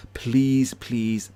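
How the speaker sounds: ordinary delivery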